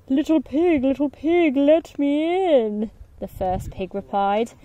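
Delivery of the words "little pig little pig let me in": big bad wolf. Wolf calling to the pig at the straw house, sly and coaxing.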